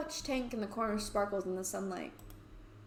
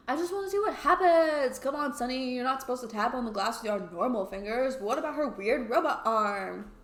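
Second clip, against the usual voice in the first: goading Toby; 3 semitones higher, 6 dB louder than usual. Acted.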